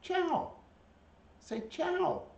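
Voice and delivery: very high, bright-pitched ciao; pet-talk voice. Real, not acted. Owner teaching a bird ciao, high pet-talk. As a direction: squeak the Italian prompt up high.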